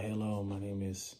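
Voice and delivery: hesitant, mumbled, unsure salesman; timid pitch. Retold or imitated. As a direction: play a nervous rookie stammering through his intro. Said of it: "don't get up in there and be like".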